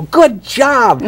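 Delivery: bright, high burst of praise; high delighted "Good job!"; excited praise. Retold or imitated. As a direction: cheer the kid on. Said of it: "And I go".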